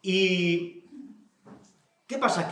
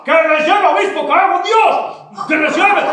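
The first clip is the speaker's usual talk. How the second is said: high, loud swearing insistence; exasperated demand; 11 semitones higher, 11 dB louder, slower than usual. Acted. Someone at the table orders the bishop to take them, exasperated demand. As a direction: order him at full shout.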